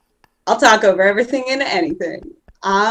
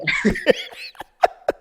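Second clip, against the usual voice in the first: breathy laugh; 6 dB quieter than usual. Real, not acted. After a teasing remark.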